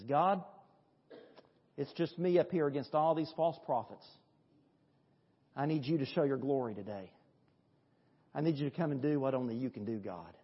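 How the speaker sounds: soft, hushed plea; quiet prayer. Acted. Elijah kneeling, praying softly to God. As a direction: pray low and earnest. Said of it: "he kneels down to pray".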